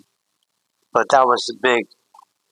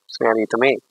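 ordinary talk, nothing marked

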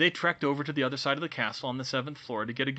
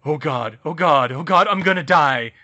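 louder, rising panic; 4 semitones higher, 14 dB louder than usual. A narrator's reading. Harry realises the danger and panics, much louder. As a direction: gasp it out in terror.

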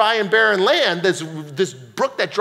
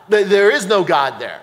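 ordinary talk

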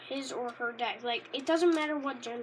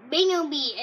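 Pokemon cry; 9 dB louder than usual. Pretend. Kid copying how the Pokemon says its name, loud creature call. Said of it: "I think that's how he says it".

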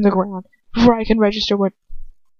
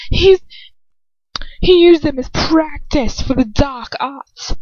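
taunting villain; 7 semitones higher than usual. Acted. Dolokhov mocking girl.

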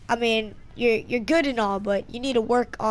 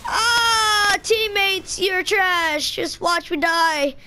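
exasperated; 7 semitones higher, much slower than usual. Real, not acted. Complaining about teammates.

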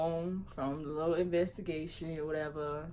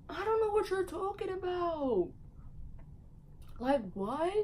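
playing dumb; 13 semitones higher than usual. Retold playing dumb to mom.

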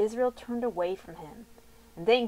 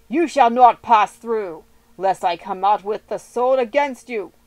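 stern refusal; 10 dB louder than usual. Acted. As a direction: plant your feet and forbid them. Edom refuses passage.

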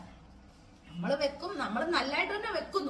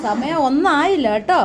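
teasing call; 14 dB louder, slower than usual. Real, not acted. Teasing husband resting, calling loudly.

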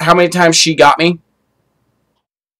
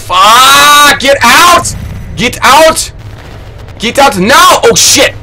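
panicked shouting; 8 semitones higher, much slower than usual. Real, not acted. Streamer fleeing a monster in a horror game, panicked shouting. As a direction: scream at them to get out, terrified.

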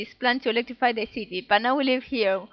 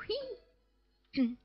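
high squeaky creature cry; 10 semitones higher, 10 dB quieter than usual. Acted. Voicing Pokemon cry.

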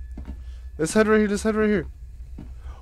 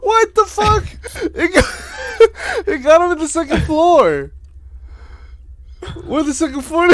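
laughing; 9 semitones higher, 4 dB louder than usual. Real, not acted. Laughing hard at friend's death.

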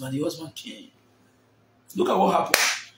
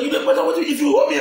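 retold angry shout; 8 semitones higher, 3 dB louder, faster than usual. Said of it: "That was like".

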